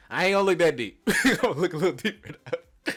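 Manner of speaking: loud, high protest; comic backtracking. Acted. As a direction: blurt a quick loud disclaimer. Backtracks on his own remark.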